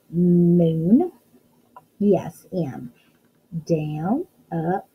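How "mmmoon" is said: long humming M at the start of moon; stretched first sound. Shown as a demonstration. Teacher quizzing kids on moon, drawn-out first M. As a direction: hold "mmm", then finish moon as a question. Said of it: "What's the first sound you hear".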